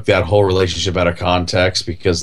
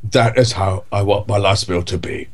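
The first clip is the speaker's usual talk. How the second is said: idle rambling in a mild Michael Caine voice; Michael Caine impression; 2 semitones higher than usual. Podcast host orders his last meal in a mild Michael Caine voice. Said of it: "I just have to talk like him".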